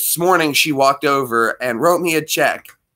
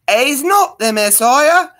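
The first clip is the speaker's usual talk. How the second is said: first try at the British mother voice, higher and slow; mock Python mother; 11 semitones higher, 4 dB louder, slower than usual. Warming up the Life of Brian mother for the chat. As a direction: start the shrill mum line, a bit tentative. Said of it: "but I'll give it a shot".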